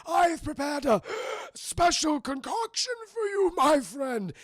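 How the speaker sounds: high, loud theatrical mad-scientist voice; shouted mock-villain purr; hammy villain. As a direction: gloat like a cartoon villain. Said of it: "He's like".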